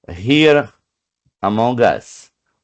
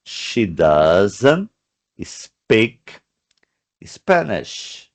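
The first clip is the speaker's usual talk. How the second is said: broken into slow separate words; teacher spacing out each word; slow model sentence; much slower than usual. Shown as a demonstration. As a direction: read it out piece by piece for students. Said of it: "Ó".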